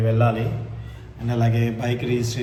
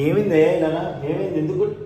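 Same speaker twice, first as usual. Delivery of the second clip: cooing concern; 6 semitones higher, 3 dB louder than usual. Real, not acted. Asking a crying child what is wrong, cooing concern. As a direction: coo it up high to a little one.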